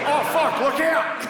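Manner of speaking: high, panicked Australian yell; Australian accent. Acted. Australian office worker warning colleagues, panicked Australian shout. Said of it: "an Australian guy in the office".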